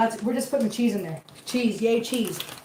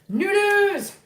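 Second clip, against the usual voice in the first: excited sing-song; 9 semitones higher, 4 dB louder than usual. Real, not acted. Announcing noodles in bowl.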